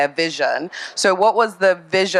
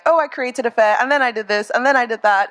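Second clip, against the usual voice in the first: higher-pitched, offhand mock retelling; imitated breezy casualness; 6 semitones higher than usual. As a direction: toss it off casually, imitating someone's account. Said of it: "you say it really casually".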